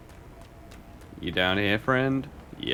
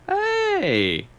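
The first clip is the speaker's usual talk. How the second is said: sudden high excited call; the player spots a way to convince her, excited call; excited exclamation; 3 dB louder than usual. Real, not acted. Sudden idea.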